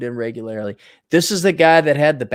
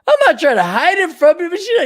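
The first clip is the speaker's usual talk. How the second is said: ordinary talk, nothing marked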